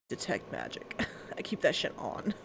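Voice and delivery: whispered aside. Real, not acted. Aside about spell.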